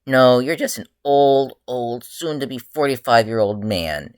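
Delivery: put-on teasing an older partner about his age. A narrator's reading. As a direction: rub it in, stretching each word. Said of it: "Izuku teased".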